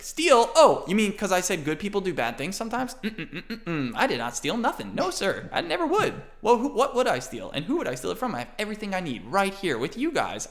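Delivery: put-on high innocent protesting little boy voice; innocent kid Aiden. Aiden protesting innocence to Thornton. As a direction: play the little innocent, fast.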